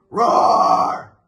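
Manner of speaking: loud breathy bear roar; a bear roaring at gooselings, very loud; grumpy roar. Acted. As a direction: roar to scare them off. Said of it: "became extra grumpy".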